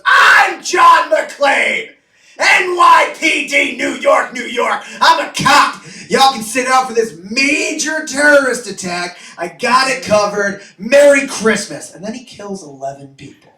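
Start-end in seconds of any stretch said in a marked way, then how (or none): 0.0-11.9 high, loud heroic yelling; over-the-top McClane shouting; hammed-up action hero shout. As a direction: bellow it like a movie cop. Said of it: "And he goes".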